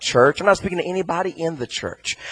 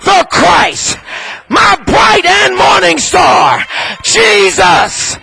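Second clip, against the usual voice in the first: loud, high whooping preacher climax; full-force preaching; 12 semitones higher, 10 dB louder than usual. Acted. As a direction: build the sermon to a ringing shout.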